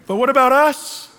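loud, high-pitched plea; protesting complaint. Retold or imitated. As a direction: push it out as an aggrieved question. Voicing his old complaint to God.

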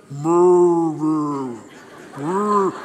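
wordless booming rumble imitating God; mock-epic drawn-out "brr" like a film's voice of God; movie God voice. Preacher mimicking God in Ten Commandments, booming wordless rumble. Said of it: "they make, uh, God speak in that movie".

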